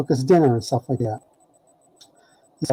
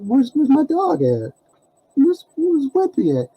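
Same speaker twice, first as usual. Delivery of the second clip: much higher, urgent pleading; alarmed demand; 11 semitones higher than usual. Retold or imitated. Teller presses Faley about Wimpy, alarmed and high. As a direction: ask desperately where the dog is.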